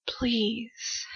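plain reading voice

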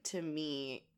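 normal talking voice

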